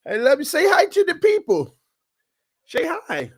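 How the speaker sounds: high-pitched doting parent. Real, not acted. Doting on baby daughter.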